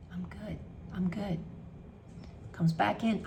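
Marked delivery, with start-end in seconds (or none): 0.1-1.4 soft whispered "I'm good"; quiet whisper. Shown as a demonstration. Coach fading the self-talk to a whisper. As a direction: let it fade to a whisper. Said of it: "You have to yell at yourself".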